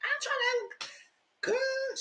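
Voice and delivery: high teasing "sit outside"; sassy tease. Retold or imitated. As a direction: shoo her out in a high sassy voice. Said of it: "I said".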